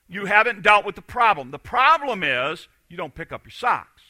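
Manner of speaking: normal talking voice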